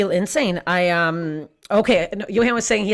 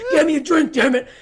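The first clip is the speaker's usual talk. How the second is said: louder, much higher demanding yell; mock aggressive; 8 semitones higher, 5 dB louder than usual. Thirsty woman in the swing orders her date, mock aggressive. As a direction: bark the order in exaggerated anger. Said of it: "how aggressive".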